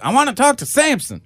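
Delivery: high-pitched movie-line impression; put-on high voice for a comedy catchphrase; Half Baked movie quote. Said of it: "I always think of the half-baked".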